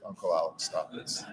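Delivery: embarrassed niece begging her uncle to stop; exasperated niece. Retold or imitated. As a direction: plead it low like an embarrassed niece.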